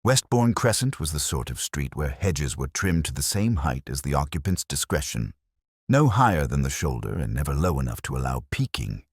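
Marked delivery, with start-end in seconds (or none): none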